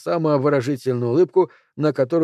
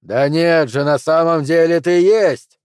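louder, slowed insistence; exclaiming; 4 semitones higher, 6 dB louder, slower than usual. A narrator's reading. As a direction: cut in, stressing есть. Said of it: "воскликнул Флинт".

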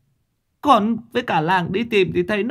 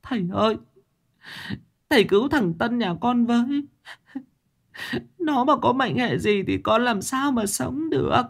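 tearful sobbing plea; 12 semitones higher than usual. A narrator's reading. A weeping mother begs the master to save her son. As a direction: beg through tears, voice pitched way up. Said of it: "bà Tám mếu máo khóc".

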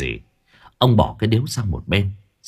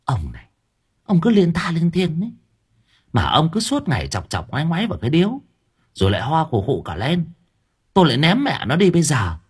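nagging wife; 6 semitones higher than usual. A narrator's reading.

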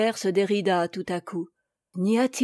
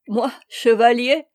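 louder, incredulous question; amused exclamation; 3 semitones higher, 7 dB louder than usual. A narrator's reading. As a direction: exclaim it in amused disbelief, without laughing. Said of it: "s'écria Chantepie en éclatant de rire".

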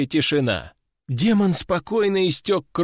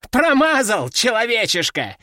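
mocking; 5 semitones higher than usual. A narrator's reading. Dodging demon taunting the teacher, mocking jeer. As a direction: sneer it at the weakling human. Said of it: "издевательски оскалился".

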